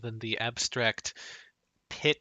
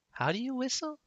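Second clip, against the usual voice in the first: lightly voiced, high questioning lilt; puzzled Hazel; 9 semitones higher, 4 dB quieter than usual. Player voicing Hazel asking about whistling, puzzled. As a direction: ask it higher and a bit puzzled.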